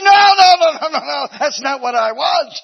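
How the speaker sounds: high, loud panicked no no no; panicked protest. Acted. Eaglet pushed to the edge, panicking loudly. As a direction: cry out in alarm at the cliff edge.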